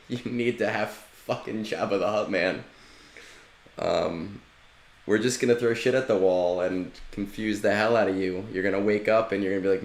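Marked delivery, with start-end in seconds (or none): none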